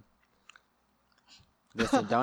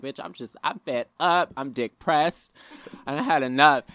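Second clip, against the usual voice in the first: fed-up rant; 6 semitones higher, 6 dB louder than usual. Retold or imitated. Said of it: "I'll be like".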